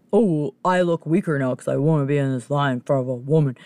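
put-on voice of a sulky queue-jumper; lightly caricatured man in line; mocking the man. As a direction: mimic his wounded pride. Mocks the man's attitude in line.

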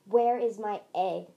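loud, firm question; demanding. A narrator's reading. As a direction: demand an answer at once. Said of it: "she demanded".

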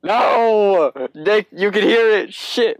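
real startled panic; dismayed cry. Real, not acted. Caught vacuum noise on call.

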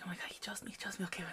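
whispering to yourself in disbelief; hushed, frantic self-talk; excited whisper. Retold or imitated. Whispered self-talk on being chosen.

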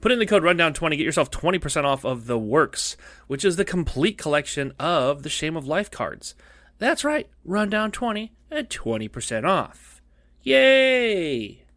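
ordinary talk

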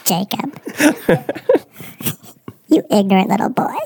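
faux-offended scolding; mock condescension. Fake expert mock-scolding the host, faux offended. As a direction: talk down to him in jest.

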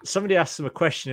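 ordinary talk

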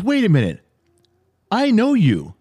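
mock realization; dawning recognition. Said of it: "He goes".

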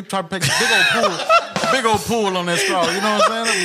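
words bubbling with real laughter; laughing while talking. Real, not acted. To co-host about the slushie, laughing. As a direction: laugh through the joke about the straw. Friend cracks up describing gulping.

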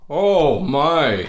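loud, high amazed gasp. Real, not acted. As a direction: react in total astonishment. Amazed at soup drunk fast.

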